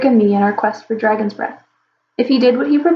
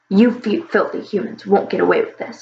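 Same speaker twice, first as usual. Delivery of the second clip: growled. Acted. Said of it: "he growled".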